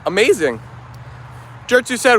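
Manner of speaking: ordinary delivery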